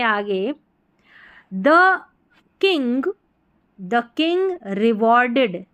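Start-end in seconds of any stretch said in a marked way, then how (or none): none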